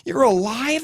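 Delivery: very high, incredulous cry; shout. A narrator's reading. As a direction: lose your cool completely. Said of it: "I shout".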